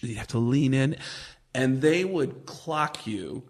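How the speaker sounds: ordinary talk, nothing marked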